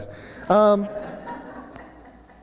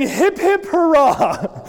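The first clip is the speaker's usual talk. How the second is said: mock cheer; 8 semitones higher, much slower than usual. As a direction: cheer it like teammates carrying a hero. Boys cheering him on shoulders.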